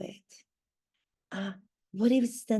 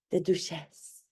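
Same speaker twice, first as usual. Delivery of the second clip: French accent; 5 semitones lower than usual. Shown as a demonstration. Correcting how her title is pronounced, French accent. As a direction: say Duchess as 'doo-SHESS', French accent. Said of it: "has more of a French accent".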